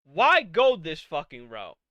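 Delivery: ordinary talk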